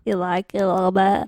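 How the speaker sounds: pinched nose voice; nasal voice. Pretend. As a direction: talk with nose held. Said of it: "Are you holding your nose?".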